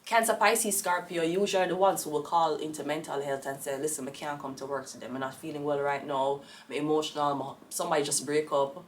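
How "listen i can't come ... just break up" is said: soft, pitiful sick-day voice; much softer whiny excuse; mock sick caller. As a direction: sound feeble on the phone to work. Water sign calling in sick.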